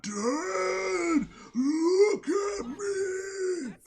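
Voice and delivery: high, strained, drawn-out zombie wail. Retold or imitated. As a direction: moan it out like a rotting zombie. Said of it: "The dude sounds like a fucking zombie".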